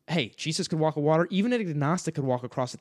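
ordinary talk

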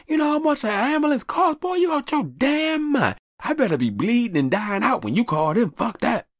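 put-on high, shouted rant of an old father; father yelling about ambulance costs, high and angry. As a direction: blast the boy like an outraged dad. Father ranting, shout heard.

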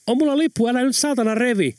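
groggy startled yell. Acted. Sleeper grabbed by thug yells, half awake and startled. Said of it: "vaan karjaisi".

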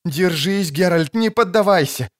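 urgent, high-pitched plea to hold on; desperate pleading. Acted. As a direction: beg him not to give up. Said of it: "беспрерывно повторяла Трис Меригольд".

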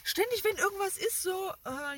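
ordinary talk

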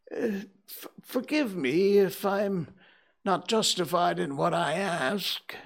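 put-on high, hesitant old-man plea; timid Scrooge voice. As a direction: stammer it out nervously. Scrooge timidly questions ghost.

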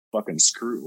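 usual speaking voice

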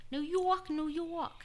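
put-on high-pitched amateur New York accent; hammed-up New York accent. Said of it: "That's my best New York accent I got".

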